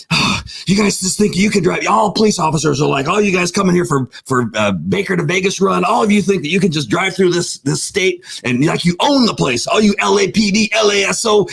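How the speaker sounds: mock the cops from out of town; ranting cop. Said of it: "And he goes".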